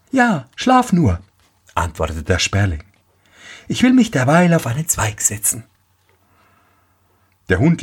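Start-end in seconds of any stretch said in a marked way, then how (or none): 0.1-1.2 high-pitched little sparrow. A narrator's reading. Sparrow answers the tired dog. 3.7-5.6 high, quick little sparrow. A narrator's reading. Sparrow answers the tired dog.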